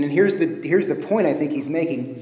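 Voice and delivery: ordinary delivery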